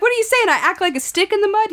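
high-pitched, playfully indignant retort; retold comeback in mock disbelief; mock offended. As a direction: act affronted, voice jumping up high.

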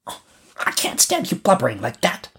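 put-on high-pitched scolding child; scornful robber girl. A narrator's reading. Robber girl scolds crying Gerda, scornful. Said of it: "the little robber girl said".

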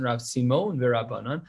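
usual speaking voice

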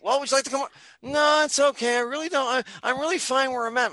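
high, timid little voice politely refusing; high squeaky voice. Acted. Cat politely declining the invitation in a high timid voice. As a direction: pitch it way up like a shy creature turning down an offer.

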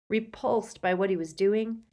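ordinary delivery

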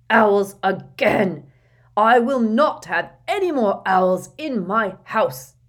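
loud slow furious roar; furious Uncle Vernon. Acted. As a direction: roar with fury. Vernon rages about owls.

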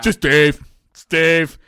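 very loud put-on blurt; mock dismissive introduction. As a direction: boom the name in mocking imitation. Mocking wife's dismissive introduction.

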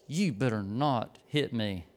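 low, slow, quiet threat; acted warning. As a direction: imitate a nurse giving a measured warning. Nurse's warning in acted quarrel.

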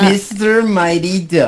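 loud, high, drawn-out playful announcing; playfully proclaimed, slow and loud; mock announcement. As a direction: hype the nickname like an introduction, stretched out. Playful announcing of the actor's nickname.

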